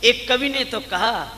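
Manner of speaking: ringing preacher's delivery; full-force preaching. Real, not acted. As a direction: drive the moral home at full voice.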